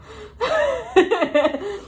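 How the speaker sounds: high burst of laughter; giggling at her own drama. Real, not acted. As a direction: laugh at your own story. Laughing at herself.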